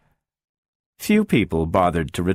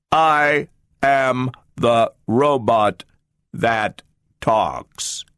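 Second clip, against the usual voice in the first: mechanical booming robot; 3 semitones higher, much slower than usual. A narrator's reading. The talking robot answers Gloria in a slow mechanical boom. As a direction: boom it out like a machine, no intonation. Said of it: "a mechanically timbred voice boomed out in words that lacked accent and intonation".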